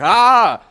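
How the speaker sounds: high, loud yelp of shock; startled yell. Acted. Plugged-in listener hit by full volume, startled yell. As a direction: yelp as if blasted through headphones.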